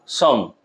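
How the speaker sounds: সাম said alone as a stressed beat; teacher isolating সাম to show its stress. Shown as a demonstration. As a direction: put weight on the syllable সাম. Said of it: "দেখো জোর দিতে হচ্ছে সামে".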